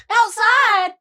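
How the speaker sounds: high, loud disbelief; incredulous. Retold or imitated. About sex by a car, high incredulous cry.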